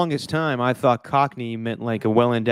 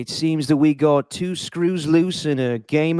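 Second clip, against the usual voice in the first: slow mock Cockney musing; Butcher; slower than usual. Host imitating Butcher from the show, slow mock Cockney. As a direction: draw it out in hammy Cockney. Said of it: "He uses a Cockney accent".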